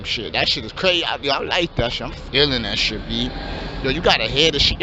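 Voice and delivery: low, laid-back street voice of a hopeful rapper; wannabe rapper. Acted. Aspiring rapper pitches his rap plan to his friend, low and laid-back.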